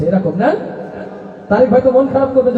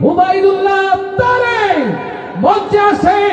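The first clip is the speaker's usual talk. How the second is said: much higher, drawn-out announcer call building up; rousing introduction; 11 semitones higher, much slower than usual. Real, not acted. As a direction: hype the crowd for the singer. Announcing singer, building to chant.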